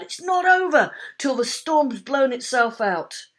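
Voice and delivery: loud, high shout; Dad shouting a warning. A narrator's reading. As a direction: shout over the storm. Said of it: "shouted Dad".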